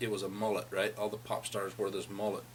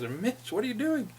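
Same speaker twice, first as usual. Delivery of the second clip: alarmed call; 11 semitones higher than usual. Retold or imitated. Catches friend burning the bedroll.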